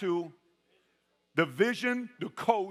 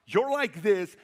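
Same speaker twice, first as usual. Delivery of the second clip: ordinary talk, nothing marked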